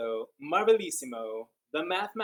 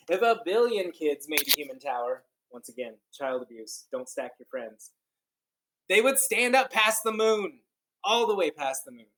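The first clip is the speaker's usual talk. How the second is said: read-aloud storytelling; 8 dB louder than usual. Acted. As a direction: read it like a wondrous tale to kids. Reads picture book aloud.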